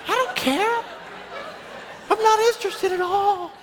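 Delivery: high, squeaky-pitched comic disbelief; mock incredulous protest. Incredulous husband's exaggerated inner protest.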